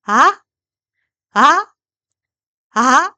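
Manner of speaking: loud 아 sweeping sharply upward; rising second tone. Shown as a demonstration. Teacher demonstrating Mandarin second tone, rising 아. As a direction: shoot 아 up like a surprised question. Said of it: "아로 올라갈게요".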